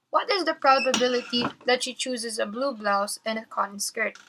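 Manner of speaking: ordinary delivery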